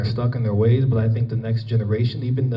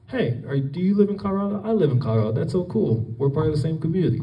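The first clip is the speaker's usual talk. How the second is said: higher-pitched, friendly acted greeting; excited stranger greeting; 6 semitones higher, faster than usual. Spotting a Colorado sweatshirt in New York, excited greeting. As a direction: act out delightedly finding someone from home.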